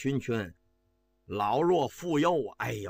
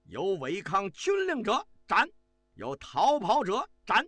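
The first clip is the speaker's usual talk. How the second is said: stern commanding shout; 7 semitones higher than usual. A narrator's reading. General commanding his troops before the march, stern proclamation. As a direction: bark out death-penalty orders like a general. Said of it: "用这个十分威严的口吻喊道".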